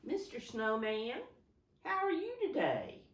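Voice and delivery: high, loud playful greeting to a snowman; pretend talk to a snowman. Teacher pretending to greet a snowman for the children, high and playful. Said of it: "I kinda have to pretend".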